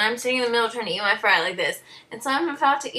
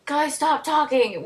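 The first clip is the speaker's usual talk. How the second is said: higher, drawn-out telling-off; imitated director; 5 semitones higher, 3 dB louder, slower than usual. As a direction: slowly scold the group as a director. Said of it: "and the director's like".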